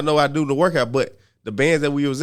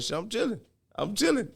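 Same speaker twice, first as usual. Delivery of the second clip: high, laughing backing down; 8 semitones higher, 6 dB quieter than usual. Retold or imitated.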